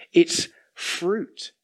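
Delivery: ordinary delivery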